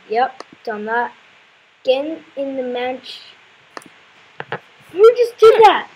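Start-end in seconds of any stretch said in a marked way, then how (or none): 4.9-5.9 high, loud, alarmed question; jumpy gamer outburst; startled cry. Real, not acted. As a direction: call out sharply in sudden fright. Startled by unseen action in game.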